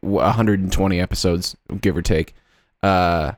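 ordinary delivery

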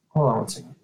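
speaking off-mic to someone nearby; turned away from the phone; turning away from phone. Real, not acted. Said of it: "sorry, that was my daughter".

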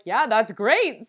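loud, slow, drawn-out enthusiasm; thrifty delight. Retold or imitated. Cheap side of shopper seizing a bargain, delighted. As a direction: act out a bargain hunter thrilled by the deal. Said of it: "the cheap side of me was like".

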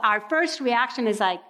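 ordinary talk

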